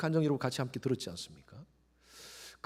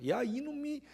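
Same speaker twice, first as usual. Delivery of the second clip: high, mock angry scold at the son; mother scolding son; 10 semitones higher, 7 dB quieter than usual. Mother venting at her son, mock angry scold. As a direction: imitate a fed-up mother cursing. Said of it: "흥분해가지고 욕이 나와버렸는데".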